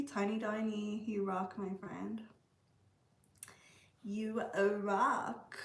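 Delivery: normal talking voice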